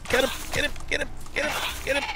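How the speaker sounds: high-pitched excited cheering; egging someone on, rapid and high; yelling from the sidelines. Real, not acted. Urging on during a fight.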